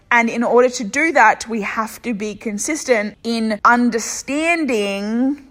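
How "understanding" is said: Australian accent. Real, not acted. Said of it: "wow, that sounded so Australian".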